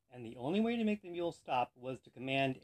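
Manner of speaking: ordinary talk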